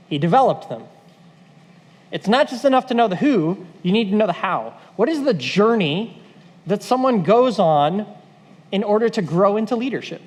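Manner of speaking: normal talking voice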